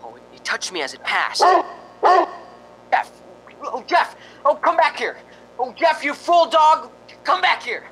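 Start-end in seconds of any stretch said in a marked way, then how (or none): 2.9-7.8 high, loud desperate calls; calling the dog. Acted. Smith calling his runaway dog Jeff, loud frantic calling. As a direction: yell after a dog that bolted.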